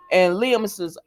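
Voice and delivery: ordinary talk, nothing marked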